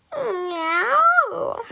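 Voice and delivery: long, high, loud meow; drawn-out cat call. Acted. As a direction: yowl like a tomcat. Narrator imitates a cat's meow.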